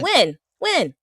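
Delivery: stunned "When?" twice; incredulous. Real, not acted. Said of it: "repeated incredulous".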